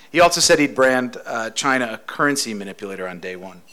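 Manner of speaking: ordinary delivery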